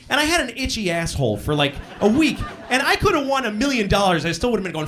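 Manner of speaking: ordinary delivery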